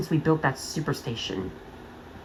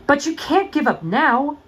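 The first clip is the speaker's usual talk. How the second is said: higher, louder, slow urgent plea; desperate plea; 8 semitones higher, 3 dB louder than usual. Acted. Friend begs Thomas not to quit, desperate plea. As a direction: beg him not to quit.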